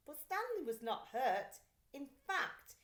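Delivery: usual speaking voice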